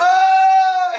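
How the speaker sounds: very high, loud mock shriek; imitated scream. Recalling the raptor scene to a friend, imitated shriek. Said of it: "You're like".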